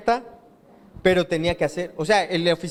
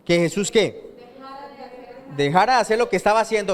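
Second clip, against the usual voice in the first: usual speaking voice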